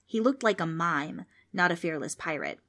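usual speaking voice

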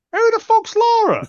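who is that, said high and incredulous; baffled outburst. Retold or imitated. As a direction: shoot way up high and loud in disbelief. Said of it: "And I went".

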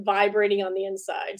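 ordinary talk, nothing marked

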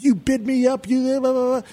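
high mock-angry yell; retold screaming. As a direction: scream at the rival who bid you up. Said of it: "I'm screaming at him".

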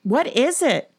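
high, loud startled question; alarmed. A narrator's reading. Erin seeing her friends' panicked faces, alarmed. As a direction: cry out in sudden alarm. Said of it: "Erin asked in alarm".

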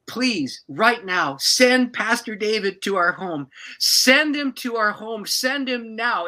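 high, loud plea building in urgency; crying out in prayer. Retold or imitated. Couple praying for their pastor to come, crying out urgently. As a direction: plead slowly and forcefully, rising as you go. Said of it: "we cried out to the Lord".

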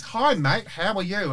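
put-on a light, breezy Australian accent, mate-ish and cheery; imitating Australian greeting. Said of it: "Why are you doing Australian?".

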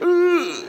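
high, strained frozen "ugh"; high stifled squeal of fright; rigid with fright. Retold or imitated. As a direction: imitate a terrified girl seizing up. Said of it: "just rigid".

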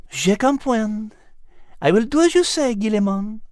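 calmly agreeing in French; French cat character. Acted. Liz agrees.

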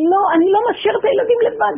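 high, loud tearful protest; desperate father refusing to leave children; crying refusal. Retold or imitated. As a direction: choke it out in distress.

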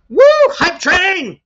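high, loud whoop; excited cheer. Real, not acted. Streamer cheers the viewers' gifted subs, real excitement. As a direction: celebrate the hype train.

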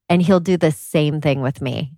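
ordinary talk, nothing marked

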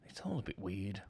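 soft breathy whisper. Real, not acted. Podcast host muttering to co-host, whispered aside.

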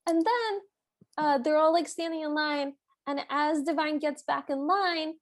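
ordinary talk, nothing marked

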